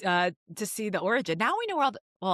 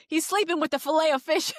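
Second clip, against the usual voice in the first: mock mobster line; 10 semitones higher, 5 dB louder than usual. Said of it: "It was like".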